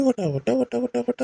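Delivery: high-pitched mock of a nonstop chatterer; teasing imitation of her yapping; mimicking chatter.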